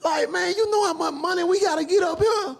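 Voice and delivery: high, loud heated scolding of a slacking worker; retold angry yelling.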